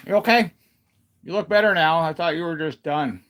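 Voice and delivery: high, loud relieved questions to the dog; relieved dog talk. Real, not acted.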